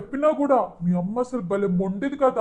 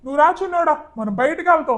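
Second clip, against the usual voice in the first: higher, loud scolding put on for show; staged anger; 5 semitones higher, 5 dB louder than usual. Father shields daughter, scolding wife and elder daughter in staged anger. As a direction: play the outraged father scolding on purpose. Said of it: "నువ్వు భలే act చేసావు".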